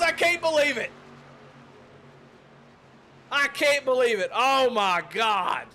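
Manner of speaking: high, loud, then slowing to awe; stunned disbelief. Real, not acted. Commentator reeling in disbelief after the finish, stunned. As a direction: let the shock spill out.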